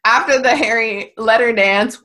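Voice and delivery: ordinary talk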